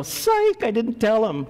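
high mocking psych; mock teasing God. God imagined pulling a prank on Manoah, mocking. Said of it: "walk away and go".

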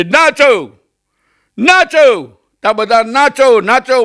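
high, drawn-out film villain's command; mock villain bellow, pitched high; Gabbar Singh. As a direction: imitate a movie bandit ordering a dance. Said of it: "એટલે પેલો ગબ્બર સિંહ કહે છે".

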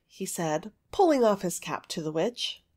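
usual speaking voice

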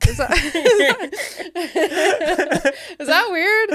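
laughing, giggly and high. Real, not acted. Asked the interviewer about sharks, laughing high and loud. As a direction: laugh the question out, self-conscious. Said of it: "laughs at her own fear".

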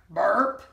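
low comic burp; spoken burp. Acted. Nibbles after eating the porridge, low burp. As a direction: say burp like a full monster. Monster burps.

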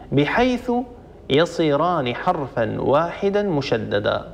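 usual speaking voice

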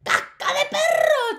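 high, loud witch shriek; screeching witch alarm. A narrator's reading. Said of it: "chilló ella".

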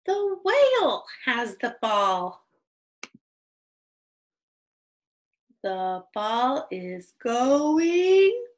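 slow, projected picture-book reading; deliberate beginner read-aloud; reading to children. Shown as a demonstration. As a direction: read each sentence slowly, stretching key words, with long stops after.

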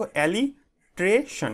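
"alliteration" slowly in pieces, alli - tre - shon; broken into syllables. Shown as a demonstration. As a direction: sound out "alliteration" with gaps between the parts. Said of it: "এইভাবে উচ্চারণ করবে". ("alliteration" ভেঙে ভেঙে ধীরে উচ্চারণ)

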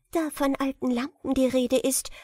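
viel höhere, zaghafte Dienerinnenstimme; junge Sklavin. Acted. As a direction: eine Magd, die vorsichtig etwas erwähnt. Sklavin spricht zur Prinzessin. (Sklavin zu Badrubur, hoch und zurückhaltend)

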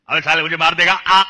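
loud, high jerky comic voice; Anupam Kher impression. Shown as a demonstration. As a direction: jolt the words like a learner driver. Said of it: "Anupam Kher".

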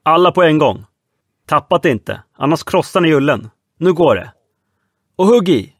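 loud, high urging orders; commanding shouts. Acted. Red Nelson ordering the crew to haul the safe. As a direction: drive the men to heave together.

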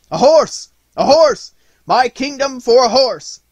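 high, very loud desperate cry; wild despairing shout. A narrator's reading. Nutcracker hemmed in by the enemy, desperate shout. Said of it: "he shouted in wild despair".

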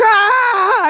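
high, loud flying yell; jumping yell. Retold or imitated. Son Tanner leaping off the building, high loud yell. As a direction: let out a wild leap cry. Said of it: "He just, like, goes".

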